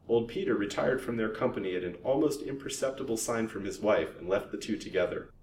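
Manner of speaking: plain reading voice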